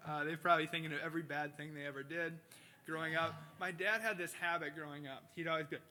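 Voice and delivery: ordinary talk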